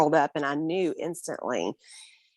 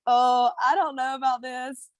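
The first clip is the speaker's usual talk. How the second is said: lightly voiced wary husband, higher; a hint of the skeptical husband, pitched up; mocking her husband's doubt, a touch higher; doubtful husband; 7 semitones higher than usual. Husband voiced in retold scene.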